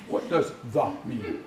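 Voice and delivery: slow, deliberate put-on question; puzzled newcomer. Newcomer asking local about English, puzzled. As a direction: play a learner puzzling over one word. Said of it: "if somebody off the boat came up".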